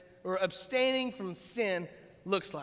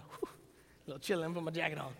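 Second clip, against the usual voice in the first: mild chilly mutter; 5 semitones lower, 8 dB quieter, faster than usual. Retold or imitated. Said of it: "I'm like".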